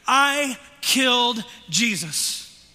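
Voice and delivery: loud, high and slow, each word struck; loud confession. Shown as a demonstration. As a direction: proclaim it slowly to the whole room. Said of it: "Say that with me".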